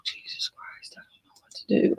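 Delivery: despairing whisper. Real, not acted. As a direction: whisper it, lost. Overwhelmed, lost for words.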